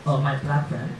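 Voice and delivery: ordinary talk, nothing marked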